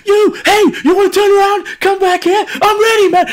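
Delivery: very high, loud challenge to a fight; retold angry shout. Drunk man challenging the departing cyclist, retold angry shout. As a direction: holler after someone riding off, hammed-up fury. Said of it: "I was that angry".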